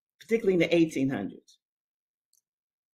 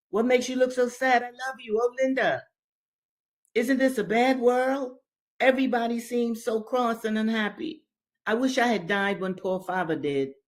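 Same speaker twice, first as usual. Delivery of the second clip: higher pitched, sad little brother; young boy's voice; 7 semitones higher than usual. Acted. William tells his sister his troubles, higher boyish sad voice. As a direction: speak as a troubled twelve-year-old. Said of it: "he said".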